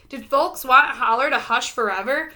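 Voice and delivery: plain reading voice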